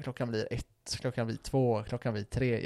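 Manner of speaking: low, soft count of passing hours; weary counting. Retold or imitated. Lying awake as the hours pass, low weary murmur.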